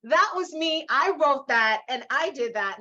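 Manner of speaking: punchy, proud declaration; real pride. Real, not acted. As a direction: claim the achievement loudly as your own. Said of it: "very proud of it".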